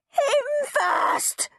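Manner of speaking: high screamed accusation. A narrator's reading. Henry pointing at Peter, screaming. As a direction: scream and point at your brother. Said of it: "screamed Henry".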